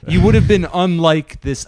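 laughing protest to his friend, high and loud; excited backpedaling. Real, not acted. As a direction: talk fast and high to smooth it over. Defending himself after friend's protest.

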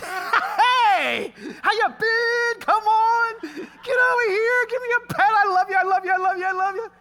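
very high, loud doggy gushing; frantic, over-the-top adoring pet voice; excited dog. Acted. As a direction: burst out like a dog greeting its owner. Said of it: "your dog's just like".

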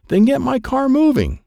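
high-pitched, urgent order; urgent demand. Acted. Dad to his son steering his car, urgent. Dad snaps back during race.

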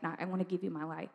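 soft, quick murmured surrender to God; quiet retold prayer. End of retold bedroom prayer.

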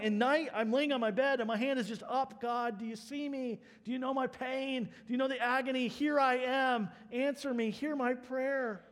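high, insistent plea, slowing at the end; pleading prayer. Retold or imitated. Preacher voices the psalmist praying from his bed at night, pleading. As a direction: imitate someone reaching up and begging to be heard.

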